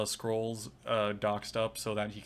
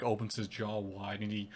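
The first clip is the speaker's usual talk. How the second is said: usual speaking voice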